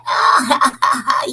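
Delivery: a taunting crowd laugh; mocking laugh. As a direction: laugh at him cruelly. Crowd jeers at Elegba.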